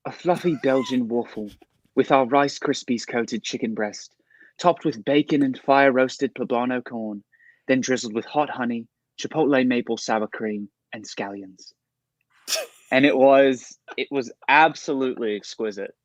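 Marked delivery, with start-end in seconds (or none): 0.1-11.7 slow, posh-leaning British accent reading the menu. Shown as a demonstration. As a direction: read the menu like a Brit, taking your time. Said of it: "Say it in a British accent".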